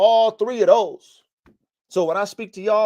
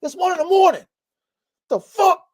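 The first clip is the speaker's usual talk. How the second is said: much higher, rising exasperated outburst; annoyed at the barking dog; 10 semitones higher than usual. Retold or imitated. At one in the morning, yelling at the barking dog, much higher exasperated outburst.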